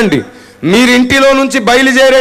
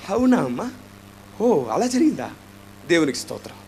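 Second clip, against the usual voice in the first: unfeeling reply; 5 semitones lower, 9 dB quieter than usual. Retold or imitated. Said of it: "అంటానా".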